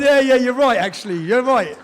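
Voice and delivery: father won over by son's excitement; conceding happily. Retold or imitated. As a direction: let the joy break through. Giving in to son's joy.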